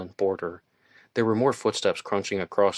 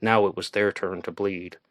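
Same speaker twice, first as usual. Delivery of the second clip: plain reading voice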